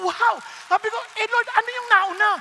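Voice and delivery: loud, high, astonished; seeing the cheque, astonished; amazed. Retold or imitated. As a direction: burst out in amazement.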